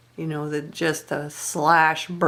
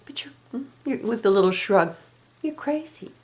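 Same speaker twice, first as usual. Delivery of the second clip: lilty raised-eyebrow voice; 5 semitones higher than usual. Retold or imitated. Mother-in-law dismissing Margaret with a lilting shrug. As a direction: lift the voice coolly, eyebrow raised. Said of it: "cool little raised eyebrow, lilty voice".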